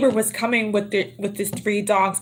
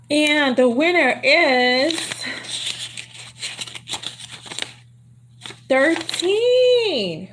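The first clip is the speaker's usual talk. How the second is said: announcing the winner; 6 semitones higher, much slower than usual. Real, not acted. Announcing lottery winner to viewers.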